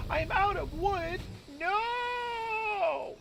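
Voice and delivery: hammed-up horror at bad news; mock panic. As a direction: gasp then wail up high, slowly. Mock panic over running out.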